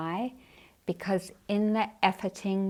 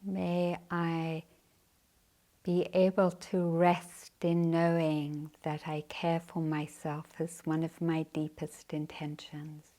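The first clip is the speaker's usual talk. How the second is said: guided meditation. Acted. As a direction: guide the sitters gently into practice.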